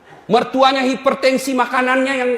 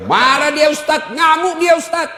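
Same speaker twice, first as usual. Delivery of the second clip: higher, louder indignant cry; hammed-up complaint from the crowd; mock protest; 7 semitones higher, 5 dB louder than usual. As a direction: blurt it out like a worried husband. Preacher voices congregant's protest.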